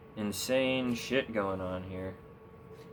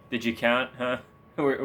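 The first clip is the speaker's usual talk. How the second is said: ordinary talk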